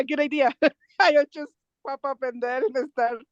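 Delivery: ordinary talk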